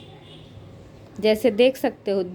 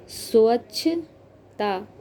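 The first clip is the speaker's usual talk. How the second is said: teacher breaking the word down; slow syllable-by-syllable; much slower than usual. Shown as a demonstration. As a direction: say अस्वच्छता slowly, each part apart. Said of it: "teacher sounds out word slowly".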